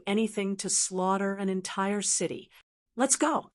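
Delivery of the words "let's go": a touch of anxiety, pitched higher; mildly anxious urging. A narrator's reading. Cultivator urging her companions to leave, understated anxiety. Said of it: "and said anxiously".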